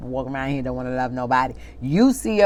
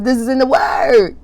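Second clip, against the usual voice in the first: preaching shout; 8 semitones higher, 5 dB louder than usual. Real, not acted. Preacher hits the point.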